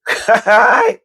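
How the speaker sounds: short high burst of laughter. Real, not acted. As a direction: break into a giggle. Laughing at the memory.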